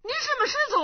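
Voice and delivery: as if you can't believe your ears; astonished. Acted. Young monk hearing the old man's claim, shocked disbelief. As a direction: pitch shoots up in disbelief.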